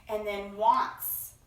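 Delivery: usual speaking voice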